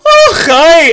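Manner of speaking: high, loud mock gasp of the queen; a startled queen's squeak before she faints; queen mother greeting her daughter before fainting. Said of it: "your mother just, like, goes".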